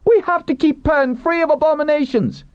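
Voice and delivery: very high zealot's outcry; fanatical cry. A narrator's reading. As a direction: cry the slogan at the top of the register. Captive woman keeps crying slogans.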